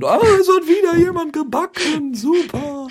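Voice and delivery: very high, loud mock enthusiasm; mock excited cheer. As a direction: gush over it in a hammed-up way. Mock excited reaction to baking. (übertrieben begeisterter Jubel)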